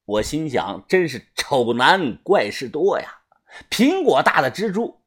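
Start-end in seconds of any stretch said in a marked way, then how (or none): none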